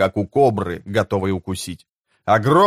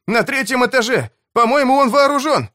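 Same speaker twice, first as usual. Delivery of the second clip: breathless shout, much higher, louder; urgent panting report; 12 semitones higher, 3 dB louder than usual. Acted. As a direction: gasp out the warning. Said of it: "задыхаясь, крикнул Бивис". (запыхавшийся тревожный крик)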